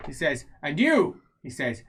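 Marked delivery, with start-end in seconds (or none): 0.6-1.2 loud, raised, pointed call; single someone out in character; imperious address. Acted. Character singles out another person.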